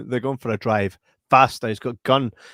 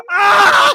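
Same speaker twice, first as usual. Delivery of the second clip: mock yell=113; 9 dB louder than usual. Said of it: "I was like".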